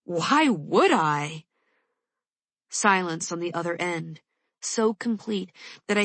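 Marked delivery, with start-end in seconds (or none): 0.0-1.5 slow, bitter retort with a laugh behind it; bitter, slow comeback, louder. A narrator's reading. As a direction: throw it back with a bitter edge. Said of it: "The bitter laugh springs out".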